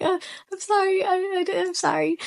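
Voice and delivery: high, timid mock apology; awkward apology. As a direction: squeak out a flustered sorry. Awkward apology to people behind.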